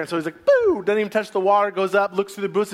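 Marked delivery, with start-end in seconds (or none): 0.4-0.8 mock startle.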